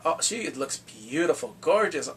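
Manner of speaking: ordinary delivery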